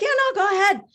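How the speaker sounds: high, breezy insistence; coaxing inner voice. Acted. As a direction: wave the objection away in a high voice. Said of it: "it was like".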